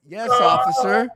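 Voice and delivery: high, slow, earnest "Yes, officer."; naive stoned politeness. Retold or imitated. Tripping on shrooms, he sweetly answers the cop, high and slow. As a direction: play the clueless tripping kid answering the cop. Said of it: "And I'm like".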